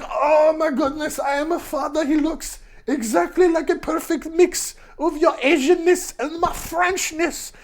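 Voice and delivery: high-pitched cartoon French accent; terrible French accent. Bernard marvelling at his baby, terrible French accent. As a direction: gush in a badly faked French accent. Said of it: "that's a terrible French accent".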